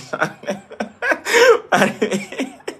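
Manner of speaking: high-pitched burst of laughter; real laughter. Real, not acted. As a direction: laugh long and high, helplessly. Laughs at what was said. (grand éclat de rire aigu)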